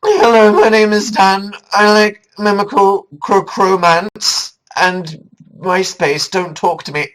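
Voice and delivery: put-on sniff, then a loud, high, awkward teen intro; awkward teen self. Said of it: "When I was fourteen, I was like".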